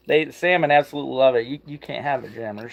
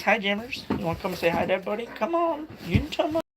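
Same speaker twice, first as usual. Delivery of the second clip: much higher, softer coaxing voice for Rick greeting the dog; voicing Rick to the dog; 6 semitones higher, 7 dB quieter than usual. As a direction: sweet-talk the dog as Rick would. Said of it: "Rick says".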